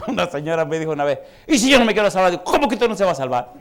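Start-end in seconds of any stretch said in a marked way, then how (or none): 1.5-2.3 high, loud mocking quote of the woman; imitating a stubborn old lady; defiant lady. Preacher voices a defiant lady. 2.4-3.5 incredulous retort. Retold or imitated. Preacher retells his own retort.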